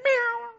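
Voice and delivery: small, high-pitched cat meow; mock meow. Shown as a demonstration. Preacher mocking a tame lion before church, high meow. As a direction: mew like a little kitten.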